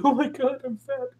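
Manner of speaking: high, hammed-up mock gasp of shock; "Oh my God, I'm fat" in a high mock wail. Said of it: "And I'm like".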